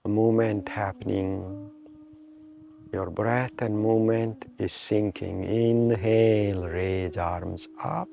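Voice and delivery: slow, measured meditation guide with long pauses; guided meditation. Real, not acted. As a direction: draw out inhale and exhale cues to pace the breath. Leads a breathing meditation.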